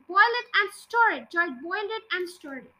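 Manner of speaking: plain reading voice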